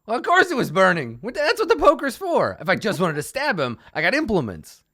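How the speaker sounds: high, loud, exasperated protest; indignant bugbear. Acted. Bugbear torturer defending his poker method, indignant and loud. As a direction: protest loudly at a silly question.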